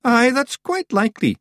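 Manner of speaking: high, loud, self-satisfied reply; proud. A narrator's reading. Rob accepting praise from Daft Wally, proud. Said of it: "swelling with pride".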